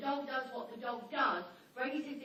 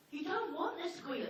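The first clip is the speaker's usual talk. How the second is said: ordinary talk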